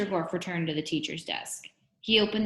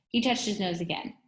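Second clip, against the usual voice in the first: ordinary delivery, nothing marked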